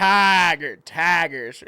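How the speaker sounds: Tigers said Tah-gers in a mock Southern drawl. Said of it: "with a southern drawl".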